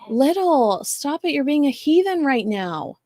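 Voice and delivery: high-pitched playful scolding; scolding the dog. Real, not acted. Host scolds her barking dog Little, high and teasing. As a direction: tell off a naughty barking dog.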